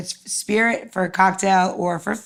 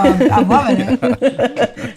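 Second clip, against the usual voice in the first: laughing, a touch louder; gleeful laugh through it; tickled, laughing while speaking; 4 semitones higher, 5 dB louder, slower than usual. Real, not acted. Laughing about biblical-era drinks.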